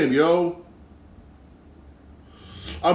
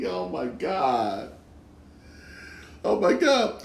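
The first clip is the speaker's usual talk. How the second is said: slow, soft words through real laughter; giggling disbelief, drawn out slow; laughing; 4 semitones higher, 8 dB quieter, slower than usual. Real, not acted. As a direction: still laughing as you say it. Laughing after Zimmer point.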